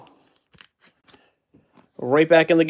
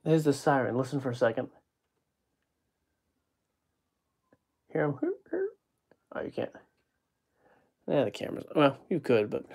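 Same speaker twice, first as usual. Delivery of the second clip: soft, hushed voice so the siren can be heard; hushed listening; 13 dB quieter, faster than usual. Real, not acted. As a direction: drop to a near whisper while listening.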